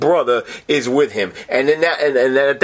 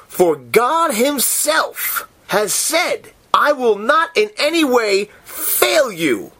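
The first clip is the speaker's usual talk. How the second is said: 1 awed slow rereading; 7 semitones higher, slower than usual. Real, not acted. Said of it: "I'm reading it again for me".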